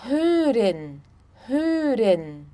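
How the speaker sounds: hören with a rounded ö, HÖ-ren; German pronunciation. Shown as a demonstration. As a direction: model hören with pursed lips. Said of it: "Vocês vão fazer um biquinho".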